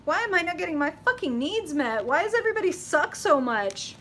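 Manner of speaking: high, loud mock frustration; exasperated venting. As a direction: whine it like you're fed up with everyone.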